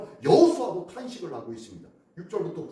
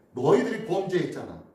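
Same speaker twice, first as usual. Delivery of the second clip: God rebuking; 7 semitones higher, 4 dB louder than usual. Retold or imitated. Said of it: "라고 말씀합니다".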